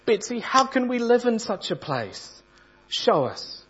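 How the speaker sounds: high, pleading wail; wailing plea. Acted. Corrie, desperate in the flea-ridden room, pleads with Betsy in a high wail. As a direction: beg Betsy for an answer, wailing. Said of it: "I wailed".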